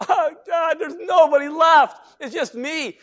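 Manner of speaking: prophet's loud self-pitying wail; whining lament. Acted. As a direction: cry out in self-pity. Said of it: "he starts again".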